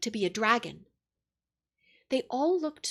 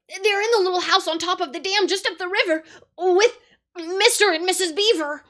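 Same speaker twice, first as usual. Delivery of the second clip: frightened pleading; 8 semitones higher, 7 dB louder than usual. A narrator's reading.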